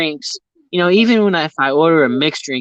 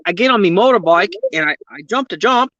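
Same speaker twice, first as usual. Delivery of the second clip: higher, amateurish Aussie impression; attempted Aussie accent; 7 semitones higher, 4 dB louder than usual. Shown as a demonstration. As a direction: have a clumsy go at sounding Aussie. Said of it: "do an Aussie accent".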